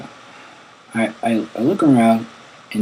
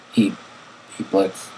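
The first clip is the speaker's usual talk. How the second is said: ordinary delivery, nothing marked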